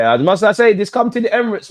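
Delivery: excited taunt. Real, not acted. Fan taunting City toward Arsenal.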